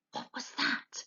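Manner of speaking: sudden breathless whisper; startled whisper. A narrator's reading. Mr Fox hearing a noise above, whispering in alarm. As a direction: hiss it out, alarmed.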